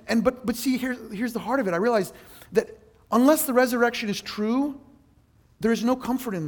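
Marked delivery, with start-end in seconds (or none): none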